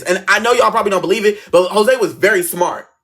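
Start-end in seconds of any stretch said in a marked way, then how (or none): none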